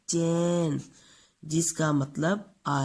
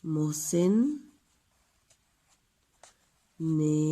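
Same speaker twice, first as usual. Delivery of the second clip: each word spaced out slowly; pausing between words for the pupils to write; slow dictation; much slower than usual. Shown as a demonstration.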